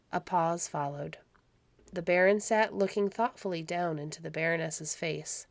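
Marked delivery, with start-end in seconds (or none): none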